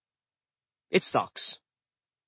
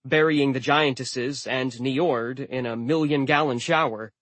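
usual speaking voice

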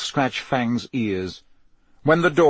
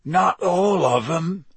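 slow, hoarse half-giant voice; hoarse Hagrid, slow and hurt; 6 semitones higher, slower than usual. Acted. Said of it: "said Hagrid hoarsely".

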